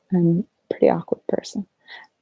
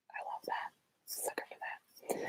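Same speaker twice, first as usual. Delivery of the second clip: whispering; 24 dB quieter, slower than usual. Real, not acted. ASMR artist gushing about a trigger.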